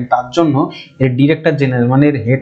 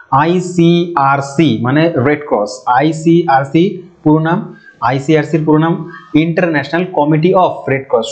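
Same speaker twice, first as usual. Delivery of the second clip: slow dictation; slower than usual. Shown as a demonstration. Teacher dictating full name.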